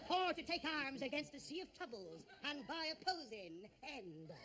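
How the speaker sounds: slow pirate-style Hamlet, hammed up; Robert Newton. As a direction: growl Shakespeare like a ham stage pirate. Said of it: "I think Robert Newton's your best".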